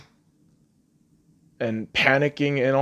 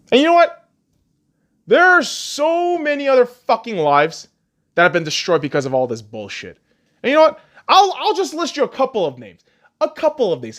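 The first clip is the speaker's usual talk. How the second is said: heated rant; 8 semitones higher, 3 dB louder than usual. Real, not acted. Heated build to the name list.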